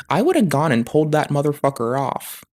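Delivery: usual speaking voice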